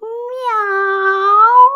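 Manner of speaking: high meow like a cat. Acted. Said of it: "кто-то сказал".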